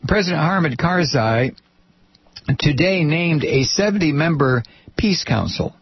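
normal talking voice

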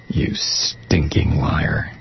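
slow hissed whisper; contemptuous whisper. Real, not acted. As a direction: whisper it with disgust. Contemptuous aside.

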